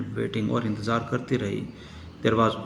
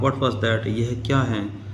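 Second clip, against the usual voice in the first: normal talking voice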